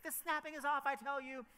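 insistent dance captain. Retold or imitated. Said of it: "And she's like".

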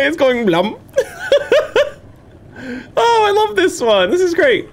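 cracking up in a high squeal; hysterical laughter. Real, not acted. Laughs at his ragdoll body.

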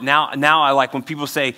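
normal talking voice

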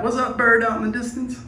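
ordinary delivery

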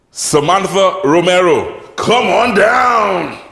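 big drawn-out game show call; booming prize announcer. Acted. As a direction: boom it out like a prize show host. Game show host calls contestant.

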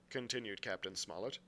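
ordinary delivery, nothing marked

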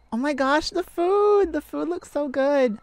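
high, loud, thrilled over the food; excited gasp at food. Acted. Delighted partner seeing the dinner, high and excited. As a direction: gush over the dinner like a kid.

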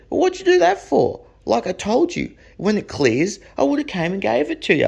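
high-pitched incredulous telling-off; re-enacted exasperation, pitched well up; exasperated. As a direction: scold in disbelief. Exasperated reply to buyer.

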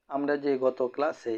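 ordinary talk

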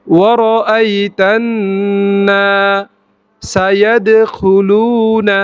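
slow segmented recitation; broken up slowly. Shown as a demonstration. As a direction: stretch each piece out for learners. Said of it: "আমি ভেঙে ভেঙে প্রথমে বলছি".

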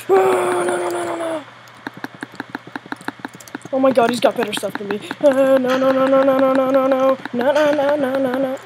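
high-pitched stream of noes; panicked wail. Real, not acted. Player facing better gear in the duel, panicked wail. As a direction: cry out no in mounting desperation.

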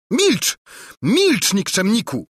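screeching. Acted. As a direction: screech at him in fury. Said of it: "zaskrzeczała księżniczka".

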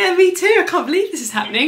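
can't contain the excitement; real giddy disbelief; overjoyed disbelief. Real, not acted. Overwhelmed after booking call ends.

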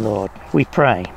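ordinary delivery

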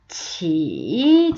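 치 drawn out, dipping low then rising; third tone. Shown as a demonstration. Teacher showing third tone, 치 dipping then rising. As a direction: dip and lift on a long 치. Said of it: "삼성".

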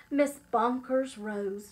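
usual speaking voice

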